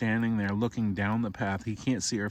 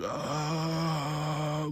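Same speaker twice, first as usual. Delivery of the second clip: eerie moan; 5 semitones higher than usual. Acted. Skeleton parents turn to him.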